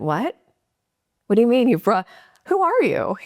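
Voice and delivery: high, rising shock at a stranger; who-are-you disbelief; startled disbelief. Retold or imitated. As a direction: voice jumps up in confused alarm.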